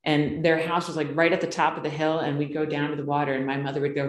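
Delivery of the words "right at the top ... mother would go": normal talking voice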